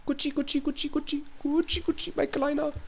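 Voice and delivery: put-on high, soft cooing at a baby; mock tender baby talk. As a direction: coo to make the baby laugh.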